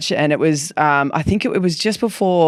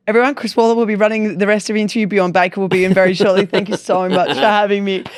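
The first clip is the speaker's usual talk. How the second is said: hurried, higher-pitched announcement to a room; mortified sign-off; 4 semitones higher, faster than usual. Retold or imitated. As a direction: rattle off an embarrassed mock sign-off. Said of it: "I was just mortified".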